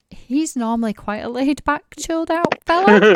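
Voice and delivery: amused irony. Real, not acted. Ironic aside after the rant.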